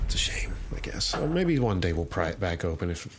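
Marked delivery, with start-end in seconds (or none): 0.0-1.1 hushed, breathy-free whispered aside. Real, not acted. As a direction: whisper it like a closing secret. Whispered aside at vault close.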